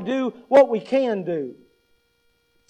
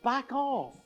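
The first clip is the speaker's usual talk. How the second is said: quiet warning; 6 dB quieter than usual. Retold or imitated. Adult to a child with grabby hands, quiet firm warning. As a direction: hush the kid away from the job. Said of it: "What do you say to it?".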